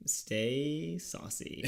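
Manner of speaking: very slow, stretched-out sign-off; milk each word of the catchphrase slowly; drawn-out catchphrase. Pretend. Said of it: "Just say it".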